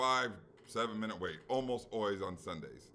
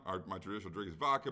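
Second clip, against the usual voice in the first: normal talking voice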